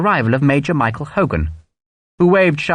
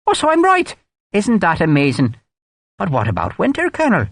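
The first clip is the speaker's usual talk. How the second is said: high, gushing Irish brogue, teasing; mock delight Irish; 8 semitones higher than usual. A narrator's reading. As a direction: overplay the amazement. Hogan mocking in Irish voice.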